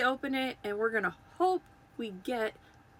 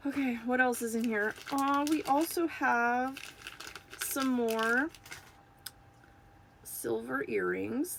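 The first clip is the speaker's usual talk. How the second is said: ordinary delivery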